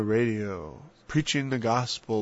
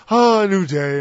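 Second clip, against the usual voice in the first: loud, higher bright wake-up; mock cheerful morning; 6 semitones higher, 10 dB louder than usual. Sinner greets the new day, loud mock cheer.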